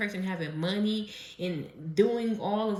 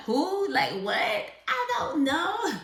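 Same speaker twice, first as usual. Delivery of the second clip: much higher and louder, stretched out baffled; high loud who what; mock clueless confusion; 11 semitones higher, 6 dB louder, much slower than usual. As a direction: play dumb loudly at someone pointing out a star. Acting clueless about celebrity visitor.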